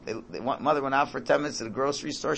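normal talking voice

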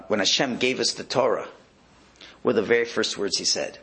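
normal talking voice

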